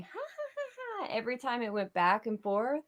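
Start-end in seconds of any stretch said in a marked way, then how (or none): 0.0-1.1 creepy laugh. Retold or imitated. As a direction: laugh like a haunted sign. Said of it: "and it was laughing".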